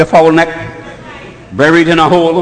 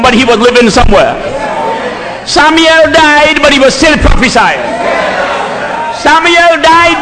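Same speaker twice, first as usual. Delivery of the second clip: full-force preaching; 8 semitones higher than usual. Acted. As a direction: preach it out hard to the church.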